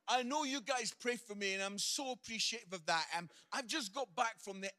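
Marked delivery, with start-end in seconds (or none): none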